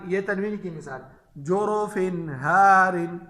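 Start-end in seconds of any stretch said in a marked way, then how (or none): 1.4-3.2 slow, loud "जोरोफिन हारिन" with a clear n; izhar recitation. Shown as a demonstration. Teacher tells students no ghunna in tanween, clear n in "जोरोफिन हारिन". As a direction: end "जोरोफिन" cleanly without humming, then "हारिन". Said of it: "तनवीन में गुन्ना नहीं करेंगे".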